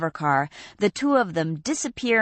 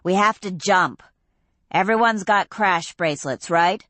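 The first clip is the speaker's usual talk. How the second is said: ordinary delivery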